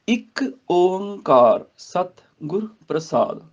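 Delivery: slow, separated ਇੱਕ, ਓੰਕਾਰ, ਸਤ, ਗੁਰ, ਪ੍ਰਸਾਦ; pronunciation demo. Shown as a demonstration. Teacher modelling the opening, slow word-by-word ਇੱਕ ਓੰਕਾਰ ਸਤ ਗੁਰ ਪ੍ਰਸਾਦ. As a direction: draw out ਓੰਕਾਰ with a nasal hum. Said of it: "ਇਹਦਾ ਉਚਾਰਨ ਦੇਖ ਲਓ ਜੀ".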